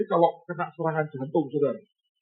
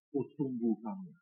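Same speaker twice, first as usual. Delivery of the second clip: soft echo; 4 semitones lower, 11 dB quieter than usual. Retold or imitated. Repeating old man's words softly.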